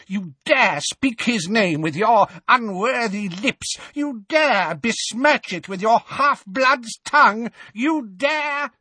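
high, loud furious tirade; raging witch. A narrator's reading. Bellatrix raging at Harry, high loud furious witch. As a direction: spit each "dare" in outrage.

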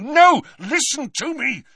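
high, loud outburst; angry. A narrator's reading. Boy stopping friends from interrupting, angrily insistent. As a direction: cut them off angrily. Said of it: "he hissed angrily".

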